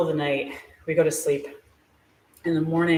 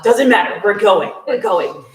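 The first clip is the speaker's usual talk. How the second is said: excited insistence; 5 semitones higher, 9 dB louder than usual. Retold or imitated. Friends insisting on the trip.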